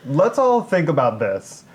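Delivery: usual speaking voice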